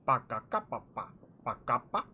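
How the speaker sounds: playful gibberish parody; mock gibberish. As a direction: babble like a pompous painter. Mocking painter with gibberish.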